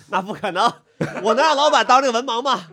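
mock the serious guest while laughing; playful self-deprecating laugh. Real, not acted. Teasing self-mockery, laughing throughout.

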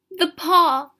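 high, loud urgent outcry; sudden cry. A narrator's reading. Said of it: "she cried".

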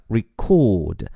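record with a light re and a stressed second syllable, re-CORD; verb stress record. Shown as a demonstration. Teacher repeating the verb form for the class, stress on cord. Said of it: "而且重音在第二个音节上，record".

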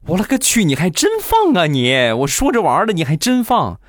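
a playful mock of disbelief at the boyfriend; shocked outburst. Girlfriend shocked he really farted, high mock surprise. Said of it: "瞬间大苹果一脸惊讶".

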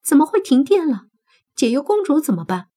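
loud, high, alarmed questions; panicked, startled demand; startled shout. A narrator's reading. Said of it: "惊喝问道".

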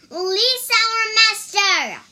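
high, very loud command; shout. A narrator's reading. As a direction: bellow the demand at an enemy. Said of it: "shouted Wukong".

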